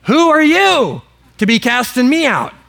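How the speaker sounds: emphatic demon challenge. Acted. Demon challenging would-be exorcists, emphatic and indignant. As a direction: confront them, punching the key words high. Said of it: "It's even more emphatic".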